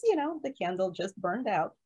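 ordinary delivery

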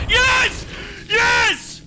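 very high, loud cheer of victory; triumphant shout. Real, not acted. Player celebrating a big hit in the game, triumphant shout.

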